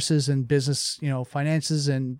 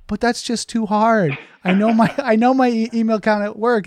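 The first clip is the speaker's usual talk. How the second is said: whiny excuse; 7 semitones higher, 4 dB louder than usual. Retold or imitated. Employee whining excuse.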